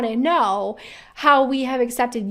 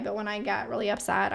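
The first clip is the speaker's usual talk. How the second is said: usual speaking voice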